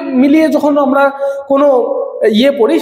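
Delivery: normal talking voice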